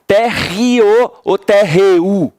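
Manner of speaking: slow, térreo then térreu with final U; teacher contrasting térreo and térreu; contrasting vowel sounds. Shown as a demonstration. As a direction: stretch térreo and térreu to compare endings. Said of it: "Assim, ó".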